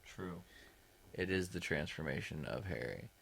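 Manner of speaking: muffled behind a hand; talking into cupped hand; hand over mouth. Real, not acted. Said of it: "I was talking into my hand".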